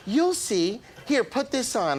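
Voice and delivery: a hint of a high, secretive voice. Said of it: "He goes".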